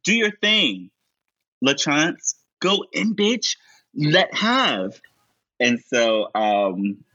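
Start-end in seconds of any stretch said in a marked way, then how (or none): 0.0-5.0 high, sassy hype; hyped-up drag cheer. Retold or imitated. Ricky hyping LaChance outside the door, high and sassy. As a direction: pump her up with sass. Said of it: "encourages Ricky to say".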